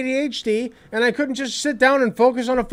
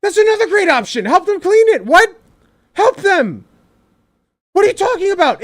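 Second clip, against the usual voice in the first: higher and louder, hammed-up amazement; loud mock disbelief; 9 semitones higher, 7 dB louder than usual. Real, not acted. Host shouts at co-host's parenting idea, mock astonished. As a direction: yell it like it's obvious.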